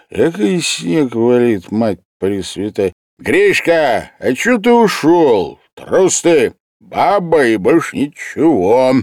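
пьяная бравада. A narrator's reading. Художник по-пьяному бранит друга.

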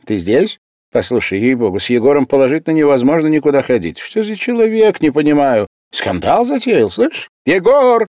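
громко, высоко и быстро, в тревоге; тараторит, взвинченный скандалом; встревоженно. A narrator's reading. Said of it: "сказал встревоженно". (медик взволнованно и быстро жалуется Васильеву)

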